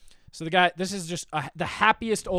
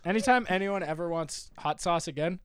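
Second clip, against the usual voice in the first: normal talking voice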